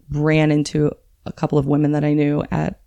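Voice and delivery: normal talking voice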